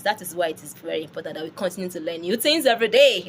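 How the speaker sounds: ordinary delivery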